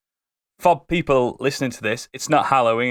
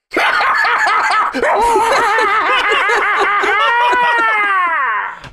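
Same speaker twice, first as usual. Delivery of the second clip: long, loud, high fiendish cackle; maniacal laugh; 7 dB louder than usual. Acted. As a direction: howl with demonic laughter as the fiend is introduced. Monster introduced, answers with laugh.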